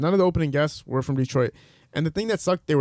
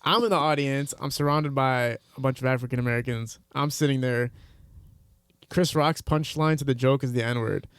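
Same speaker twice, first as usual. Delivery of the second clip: ordinary talk, nothing marked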